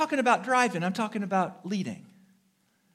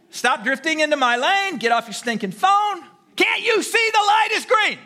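mock road-rage shout, higher and louder; angry driver yelling; 6 semitones higher, 6 dB louder than usual. Preacher playing an angry driver yelling at traffic. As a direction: yell at the driver who cut you off.

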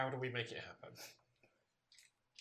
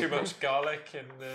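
laughing; 4 semitones higher, 9 dB louder than usual. Real, not acted. Jokes about the accent.

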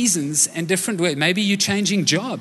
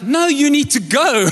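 urgent manager; 8 semitones higher than usual. Retold or imitated. Store manager urging him to leave.

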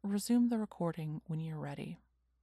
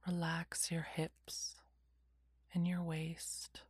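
whispered guided meditation; slow calming whisper, lots of pauses; soft spa whisper; 2 semitones lower, 4 dB quieter than usual. Acted.

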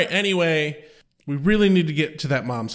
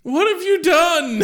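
mock horror; 11 semitones higher, 5 dB louder than usual.

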